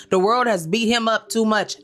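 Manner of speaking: normal talking voice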